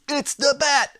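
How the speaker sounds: a light Brooklyn henchman shout; Brooklyn accent. Retold or imitated. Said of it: "in a Brooklyn accent".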